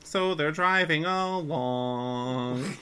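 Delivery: very slow, stretched-out words; silly drawn-out delivery. Pretend. Co-host sillily repeats the recap, drawn out very slowly. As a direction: drag every word out playfully.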